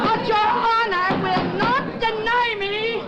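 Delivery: high, slow impassioned appeal; impassioned plea. Acted. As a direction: plead with rising fire. Defiant plea to the judge.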